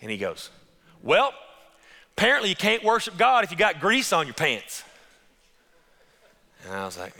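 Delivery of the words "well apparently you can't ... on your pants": high, loud, indignant complaint; loud retold protest. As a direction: blurt it out loud and offended. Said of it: "he said it so loud".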